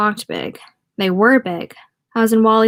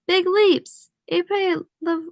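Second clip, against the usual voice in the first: put-on high-pitched, gleeful French accent; 10 semitones higher, much slower than usual. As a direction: echo it excitedly with French vowels. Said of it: "He has a French accent?".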